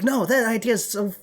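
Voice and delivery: high, scornful mock of coworkers. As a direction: sneer it like a snide boss. Coworkers mocking her idea.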